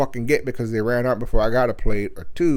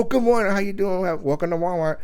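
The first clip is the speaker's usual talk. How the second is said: mock cheerful greeter; 8 semitones higher than usual. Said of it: "you go".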